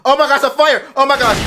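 high, loud burst of alarm; panicked cry. Real, not acted. Viewer seeing the plane on fire, panicked cry.

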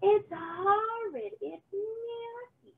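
slow, high horrified wail; almighty scream. Acted. Witch outside seeing the sweet party, screaming in horror. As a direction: howl out in disgust and terror. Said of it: "came an almighty scream".

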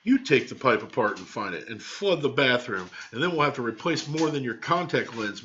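plain reading voice

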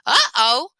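high, loud dismayed uh-oh. Acted. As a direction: realise you're caught. Lapin finds oily puddle.

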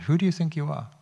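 high-pitched mock telling-off; scolding grown-up. Said to precocious kids in England, high mock scolding. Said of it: "thing said to kids in England".

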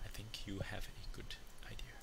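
hushed aside, barely voiced; whisper. Real, not acted. Whispering an aside to the person next to her. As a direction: whisper it so the mic barely catches it.

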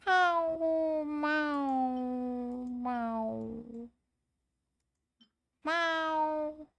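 high then fading meows; cat meow. Pretend. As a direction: meow like a kitten. Meowing at cat in game.

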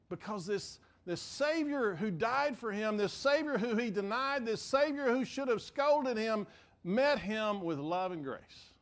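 ordinary talk, nothing marked

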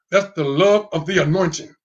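normal talking voice

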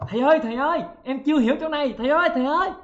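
high-pitched pretend student calling for help; playful wail of a lost pupil; mock crying out. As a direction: call out urgently to the teacher, hammed-up. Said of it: "la làng thì kêu nè".

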